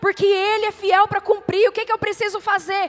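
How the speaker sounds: loud, urgent exhortation; fervent preaching. Real, not acted. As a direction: drive each phrase home at full force. Preaches at full force.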